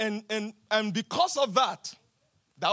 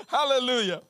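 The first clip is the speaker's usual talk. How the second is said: ordinary talk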